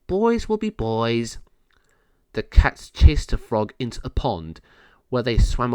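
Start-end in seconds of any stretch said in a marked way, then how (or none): 0.1-1.4 resigned sigh. Acted. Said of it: "Dory sighed".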